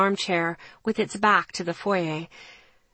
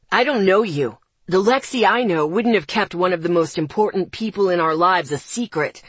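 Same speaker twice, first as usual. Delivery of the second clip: furious rant; 5 dB louder than usual. Acted. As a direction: tear into her.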